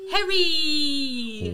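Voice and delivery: loud whine, Y stretched extra long; Hermione. Acted. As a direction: call it out with an endless final vowel. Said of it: "he makes the Y".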